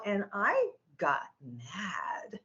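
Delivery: very slow, soft near-whisper; hushed, drawn-out. Real, not acted. Teller reaching the moment she got mad, hushed and slow. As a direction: drop to a hush and stretch each word. Builds to her turning point.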